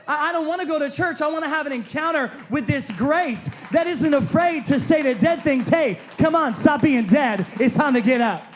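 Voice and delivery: loud, impassioned preaching building to a shout; preaching at full force. Real, not acted. Preacher urging church toward grace that raises dead things, full-force preaching.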